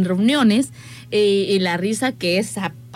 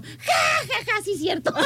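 high forced ha ha ha; fake laugh; 10 semitones higher, 3 dB quieter, slower than usual. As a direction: laugh along awkwardly, high. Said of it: "se acuerda y yo".

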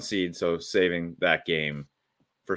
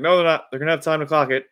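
much higher and louder excited protest; mock Tony Romo, pitched up and loud; imitating Tony Romo; 9 semitones higher, 8 dB louder, faster than usual. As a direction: insist like a hyped color commentator. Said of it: "Tony Romo's like".

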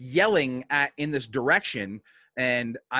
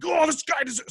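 high, strained mock outburst; retold outburst; 3 dB louder than usual. Wrestler retelling the moment he snapped on a kid, mock outburst. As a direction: pitch leaps up as if suddenly snapping.

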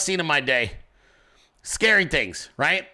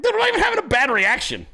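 retold protest; 10 semitones higher, faster than usual. Retells protesting he was fine.

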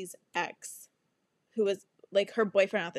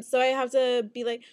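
ordinary talk

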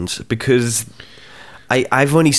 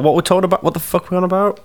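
baffled; 9 semitones higher, faster than usual. Real, not acted. Host has lost the thread.

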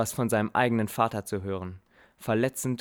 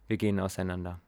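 ordinary delivery

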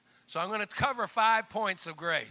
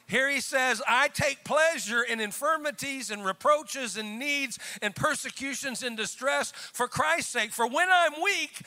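high, ringing scripture proclamation; forceful preaching; 8 semitones higher, 5 dB louder than usual. Real, not acted. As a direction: build the verse into a punchy climax.

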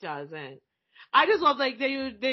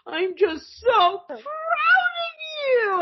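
hammed-up pride; 13 semitones higher than usual. Father praising his gun-toting daughter, high hammed-up tearful pride. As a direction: gush as if choked up with pride.

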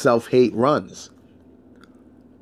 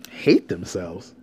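baffled echo; 6 semitones lower, 3 dB quieter, much slower than usual. Retold or imitated. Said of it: "I'm like".

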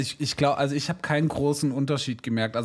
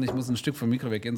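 usual speaking voice

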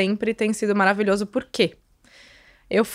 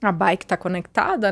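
usual speaking voice

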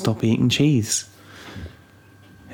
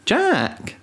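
put-on high-pitched excited toddler shout of a name; imitating toddler. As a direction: squeal it like a little kid spotting a skeleton. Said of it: "she just points at it and goes".

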